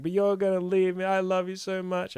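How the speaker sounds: mock-tearful high plea to boyfriend; mock clingy girlfriend. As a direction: whine it out like a clingy partner. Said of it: "And you'll be like".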